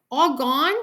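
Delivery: put-on high, loud small-child question; wondering little boy; questioning toddler. A narrator's reading. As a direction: ask it in baby talk. Toddler asks about his teeth.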